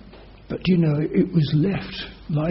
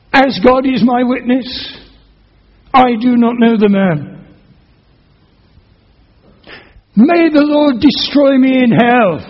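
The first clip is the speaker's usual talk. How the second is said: loud, high and desperate, swearing he doesn't know him; cursing and swearing; 8 semitones higher, 11 dB louder than usual. Acted. Peter to the crowd, cursing and swearing loud and high. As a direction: curse it out, higher and louder, like a cornered man. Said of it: "he began to curse and swear".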